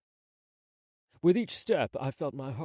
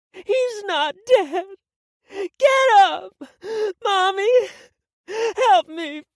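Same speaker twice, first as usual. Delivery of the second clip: loud, high, slow desperate wails; screaming and crying; 14 dB louder, much slower than usual. Acted. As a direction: scream for help, sobbing through it. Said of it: "as her cries met my ears".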